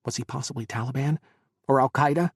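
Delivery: plain reading voice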